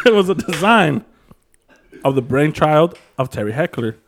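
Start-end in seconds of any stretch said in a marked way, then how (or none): 0.1-1.1 laughing. Real, not acted. Host laughing while reading.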